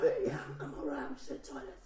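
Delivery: hushed, breathy mock whisper from a toilet stall; imitating a whisper. Friend whispering down the phone from the toilet, mock whisper. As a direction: whisper it like you're hiding. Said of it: "he was, like, whispering".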